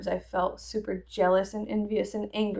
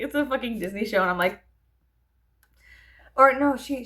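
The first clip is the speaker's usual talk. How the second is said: ordinary talk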